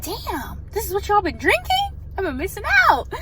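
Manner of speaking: high, loud amazed delight; can't believe how good it is. Real, not acted. Amazed by the drink.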